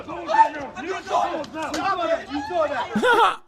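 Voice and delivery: real high burst of laughter; a long real laugh. Real, not acted. Laughs at the clip.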